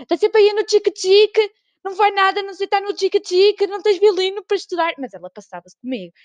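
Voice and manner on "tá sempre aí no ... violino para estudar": higher, louder scolding grandma; imitating grandma nagging. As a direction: nag like an exasperated grandmother. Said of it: "e ela". (avó a ralhar, voz aguda e alta)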